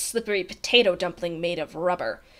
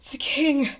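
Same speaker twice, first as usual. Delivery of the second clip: higher, out-of-breath delivery; panting; 4 semitones higher than usual. A narrator's reading. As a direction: gasp it out, short of breath. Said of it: "panted Bartholomew".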